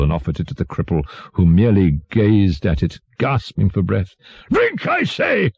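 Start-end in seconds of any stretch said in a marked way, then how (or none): 4.5-5.5 very high, drawn-out command without real volume; shouting monster. A narrator's reading. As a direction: order him to drink as a raging king. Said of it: "shouted the monster".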